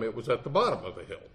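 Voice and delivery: ordinary talk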